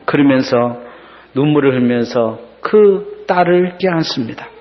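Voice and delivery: ordinary talk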